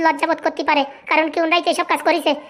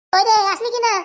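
higher-pitched call to another room; 5 semitones higher than usual. Acted. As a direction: call them in, pitch raised. Calling someone in to eat.

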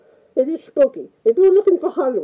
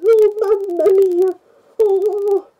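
hammed-up fright; 6 semitones higher, slower than usual. Frightened by the ghost memory.

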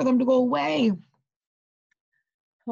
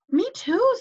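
mouse voice; 13 semitones higher than usual. Acted. Field mouse voiced, squeaky high.

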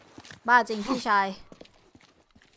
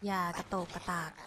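softer repeat, telling herself to keep still; hushed; 5 semitones lower, 9 dB quieter than usual. Shown as a demonstration. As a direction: say it again much softer. (พูดซ้ำเบาลงอย่างระวังตัว)

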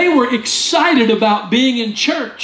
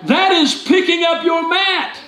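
preaching shout; 8 semitones higher, slower than usual. Real, not acted. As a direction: proclaim it to the whole church. Preacher driving home point.

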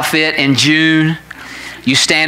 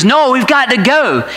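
urgent call; 8 semitones higher than usual. Retold or imitated. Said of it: "She says".

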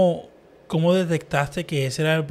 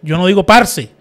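louder, higher, real indignation; worked up; 4 semitones higher, 9 dB louder than usual. Real, not acted. Guest rejecting the word parce, getting worked up. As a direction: get carried away rejecting that word. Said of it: "Perdón, me exalté".

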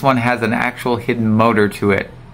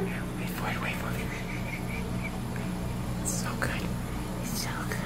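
hushed whisper, almost silent; tense whisper; 17 dB quieter, faster than usual. Real, not acted. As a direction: whisper so the moment isn't broken. Waiting breathlessly for dice to stop.

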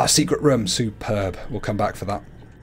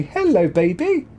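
high cooing greeting; 7 semitones higher than usual. Real, not acted. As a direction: coo it to a pet. Greets her cat.

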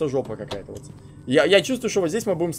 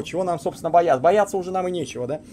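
normal talking voice

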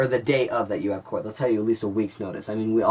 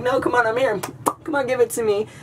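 high-pitched, pestering goad; mock begging; 13 semitones higher, 3 dB louder than usual. Crawling after the bully to freak them out, mock-eager. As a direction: plead mockingly, voice way up high.

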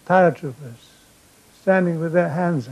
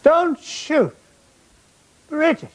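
high shouted plea; 10 semitones higher, slower than usual. Retold or imitated. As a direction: yell it up the hill, high. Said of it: "So I shouted".

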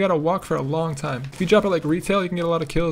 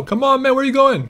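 raised, high urgent call; calling after teammate; 7 semitones higher, 5 dB louder than usual. Real, not acted. Player to a wandering teammate, urgent calling. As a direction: call out impatiently.

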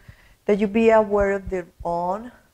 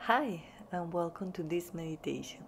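guided meditation; 3 semitones lower, 17 dB quieter than usual. Acted. Teacher welcoming listeners to a breathing meditation, soft guided meditation voice. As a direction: ease them gently into calm, quiet and unhurried.

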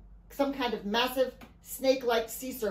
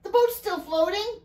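high-pitched protest, lifting at the end; calling in protest; 10 semitones higher, 4 dB louder than usual. A narrator's reading. Alfie argues against abandoning ship, calling out in protest. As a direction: protest it as if unbelieving. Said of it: "Alfie called".

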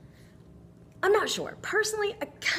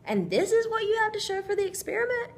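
incredulous mock question; 11 semitones higher than usual. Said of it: "So he's like".

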